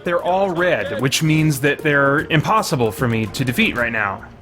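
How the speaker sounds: ordinary talk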